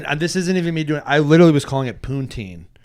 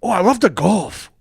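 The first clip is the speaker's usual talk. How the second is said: put-on Scottish Chinese accent; 3 semitones higher than usual. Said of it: "Scottish Chinese guy".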